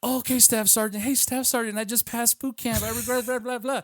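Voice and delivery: high, sing-song mimicking voice; mocking niece. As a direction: parrot an obedient recruit, trailing into blah-blah. Mocking niece's obedient phone answer.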